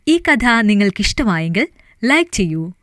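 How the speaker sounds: loud, upbeat call to like and subscribe; channel outro. Acted. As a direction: sell the outro brightly to listeners. (Channel ശ്രോതാക്കളോട് ഉറക്കെ, ഉത്സാഹത്തോടെ)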